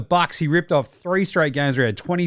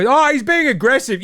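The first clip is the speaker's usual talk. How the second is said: high mocking parroting; mocking pundit; 10 semitones higher, 4 dB louder than usual. Host parroting a pundit's empty insight, mocking.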